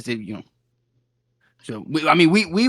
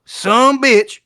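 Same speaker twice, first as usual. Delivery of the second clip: higher, louder mock quote; quoting her; 5 semitones higher, 6 dB louder than usual. Adventurer quoting the woman's insult, mock loud. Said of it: "I quote".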